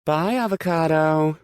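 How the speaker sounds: high, loud sing-song goodbye; mocking call. Rachel calling after Aspen, mocking farewell. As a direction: call it after her, gloating. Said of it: "Rachel calls after her".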